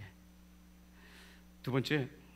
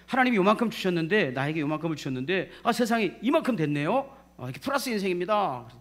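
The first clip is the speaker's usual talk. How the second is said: loud, high-pitched proud reporting; excited report; 4 semitones higher, 6 dB louder than usual. Acted. Servant reports the gain to his master, excitedly. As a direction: exclaim it like a delighted servant.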